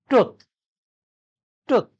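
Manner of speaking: "tooth" said wrongly with a short, clipped vowel; short-vowel slip on "tooth", rhyming roughly with "book"; mispronounced tooth. Shown as a demonstration. Teacher showing learners a mispronunciation, "tooth" with too short a vowel. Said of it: "This is a mispronunciation".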